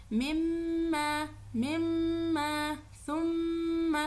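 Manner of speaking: slow, measured tajweed recitation with held nasals; slow chanted recitation of single words; Quranic recitation. Shown as a demonstration. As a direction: recite each word like a teacher modelling tajweed.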